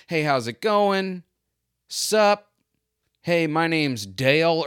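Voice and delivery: a hint of an overly chummy stranger; friendly neighbour greeting. Strip-mall shop owner greeting the narrator, lightly voiced chummy stranger. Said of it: "he just immediately not".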